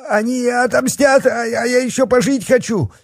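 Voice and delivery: high-pitched panicked fat man; terrified pleading. A narrator's reading. Terrified fat man begs for life.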